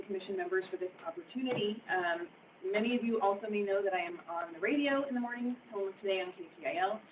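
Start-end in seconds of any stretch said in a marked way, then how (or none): none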